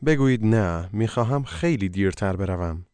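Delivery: ordinary talk, nothing marked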